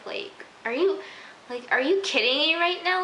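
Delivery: real exasperation rising in pitch; upset disbelief. Real, not acted. Viewer protesting racial profiling in the episode, upset and incredulous. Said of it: "it just made me so upset".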